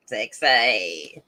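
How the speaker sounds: low North Queensland bogan drawl. Shown as a demonstration. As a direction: drawl the last syllable in broad bogan Australian. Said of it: "my full North Queenslander Bogan drawl".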